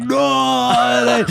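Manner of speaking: high, drawn-out mock cry of disbelief; shocked crowd cry. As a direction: imitate a crowd's stunned "no". Crowd's shocked reaction, retold.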